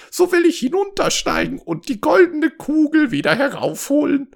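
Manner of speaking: high-pitched frog voice with quacks; disgusting frog. A narrator's reading. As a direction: haggle as a squawky slimy frog. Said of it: "Der widerwärtige Frosch antwortete". (widerwärtiger Frosch, hohe Stimme)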